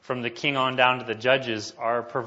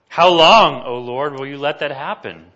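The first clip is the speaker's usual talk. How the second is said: anguished cry; 3 semitones higher, 10 dB louder than usual. Acted. Preacher voices lament to God.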